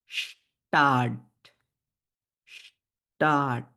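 স্টাড as slow "sh-taat", split; long "sh", pause, stretched "taat" for স্টাড; German pronunciation demo. Shown as a demonstration. Teacher models word for city, স্টাড slowly split. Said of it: "এরকম উচ্চারণ হয়".

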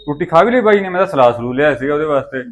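surprised outburst. Acted. Husband surprised, voice raised.

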